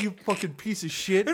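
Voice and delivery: imitated irate outburst; imitating mad gamer. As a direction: act out an offended player cursing someone out. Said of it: "They get so fucking mad".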